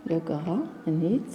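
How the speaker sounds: slow, soft "granit" with audible t; final T pronounced. Shown as a demonstration. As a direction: stretch granit and sound its T. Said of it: "On prononce le T". (granit lentement, T final prononcé)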